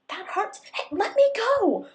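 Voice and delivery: high, loud cry of pain and alarm. A narrator's reading. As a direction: scream at him to let go. Customer grabs Belle's wrist.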